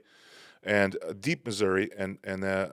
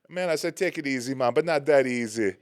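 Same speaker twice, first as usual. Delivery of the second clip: higher-pitched mock Jamaican accent; bad Jamaican accent; 3 semitones higher, 4 dB louder than usual. Jamaican guy in Missouri telling a customer, bad Jamaican accent. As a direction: do a badly faked Jamaican lilt. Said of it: "that was a bad accent".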